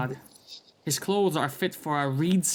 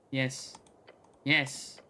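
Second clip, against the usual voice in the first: ordinary delivery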